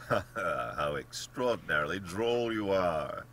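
hearty acted amusement; amused laughter. A narrator's reading. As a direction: laugh out loud, then carry the amusement through. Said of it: "Laughter shook the general".

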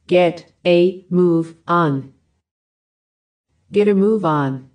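ordinary talk, nothing marked

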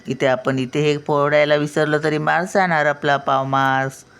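ordinary talk

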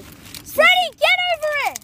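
shouting for the dog; calling out. Real, not acted. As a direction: call the dog loudly.

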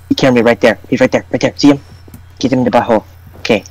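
normal talking voice

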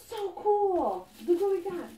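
gasp, then high excited coo; thrilled, high-pitched admiration; delighted gasp. Real, not acted. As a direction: gasp and gush over a new find. Delighted at the next item.

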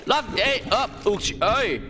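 high startled yelps; panicked yelp. Real, not acted. Jump scare in horror game.

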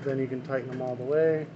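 normal talking voice

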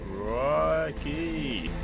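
ordinary talk, nothing marked

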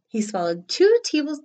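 plain reading voice

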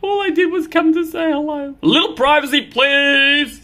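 high, loud cat lines; light, hammed-up cat talk; cat voices.

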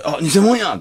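high, loud teasing jab; playful tease. Retold or imitated. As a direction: tease her gleefully. Teasing Arii on meeting.

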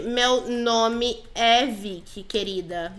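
"Meu nome é Vicky" said slowly, word by word; slow correction. Pretend. Player corrects her nickname with "Meu nome é Vicky", slow and emphatic. Corrige o apelido, devagar.

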